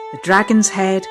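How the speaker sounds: ordinary delivery, nothing marked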